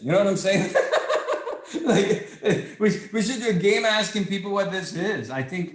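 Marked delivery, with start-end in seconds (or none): 0.8-1.8 laugh. Real, not acted. Laughs at own joke.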